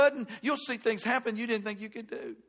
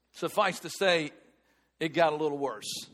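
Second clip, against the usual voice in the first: ordinary talk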